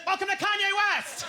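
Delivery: high, loud announcer voice; hammed-up greeting to tourists; chirpy holiday resort host; mock resort welcome.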